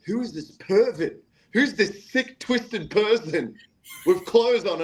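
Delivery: high-pitched mock outrage at a pervert; mock scandalized. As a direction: imitate appalled onlookers, voice pitched right up. Crowd acted as appalled onlookers.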